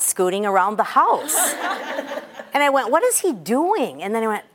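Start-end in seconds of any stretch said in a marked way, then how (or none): 1.4-2.5 a breathy burst of laughter; real laugh. Real, not acted. Laughing at her son's scooting. 2.9-4.0 higher, slower puzzled mother; surprised. Retold or imitated. Mother sees son butt-scooting.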